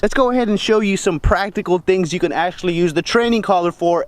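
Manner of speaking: ordinary talk, nothing marked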